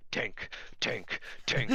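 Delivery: imitated orc muttering, nearly voiceless; under the breath. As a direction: repeat it under your breath like a chant. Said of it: "hears under the orc's breath".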